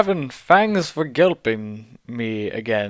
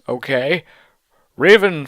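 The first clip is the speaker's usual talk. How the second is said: ordinary delivery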